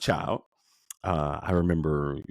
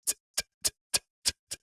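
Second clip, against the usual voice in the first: soft voiceless tick tick of a mechanical heart valve; whispered ticking like a clock; imitated heart valve ticking; 5 dB quieter, much faster than usual. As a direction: click out a quiet ticking with the tongue. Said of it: "you start hearing this tick".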